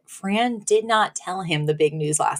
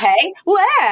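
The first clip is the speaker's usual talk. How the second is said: startled squeak; 11 semitones higher, 3 dB louder than usual. Retold or imitated. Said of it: "And he goes".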